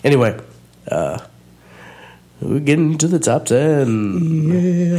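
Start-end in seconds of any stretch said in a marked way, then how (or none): 2.4-5.0 radio announcer. Acted. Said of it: "I can get really radio announcy".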